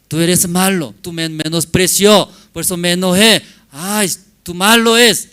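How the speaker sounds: loud, high-pitched wife's complaint; hammed-up outburst of hurt accusations; angry accusation. Wife accuses husband in a marriage game, acted angry.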